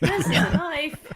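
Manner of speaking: boisterous imitation of the Aussie movie hero; Crocodile Dundee. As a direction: ham up the famous movie line in an Aussie voice. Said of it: "you don't need to see Crocodile Dundee".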